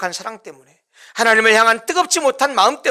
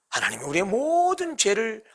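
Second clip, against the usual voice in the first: ordinary talk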